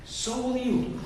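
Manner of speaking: ordinary delivery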